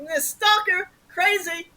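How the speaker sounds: sarcastically spooked; thinking the caller is a stalker; mock alarm. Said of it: "I'm like".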